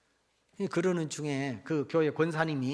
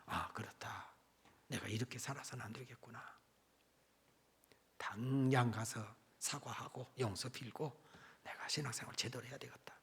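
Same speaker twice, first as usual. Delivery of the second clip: hushed resolve; 4 semitones lower, 9 dB quieter than usual. Retold or imitated. Deacon's inner resolution.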